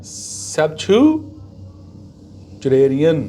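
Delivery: "Septuagenarian" drawn out very slowly, syllable by syllable, sep-tyoo-a-je-NAIR-ee-an; slow pronunciation demo. Shown as a demonstration. Teacher slowly spells out the sound of septuagenarian for students. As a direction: stretch every syllable of "Septuagenarian" for learners. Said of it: "pronunciation क्या है?".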